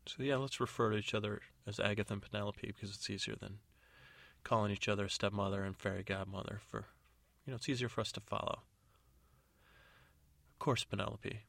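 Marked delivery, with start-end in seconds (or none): none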